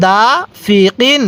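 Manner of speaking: slow, syllable by syllable হাসিদিন; slow recitation. Shown as a demonstration. Teacher reading the word slowly for students to copy. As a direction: draw each syllable out carefully. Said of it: "আরেকবার".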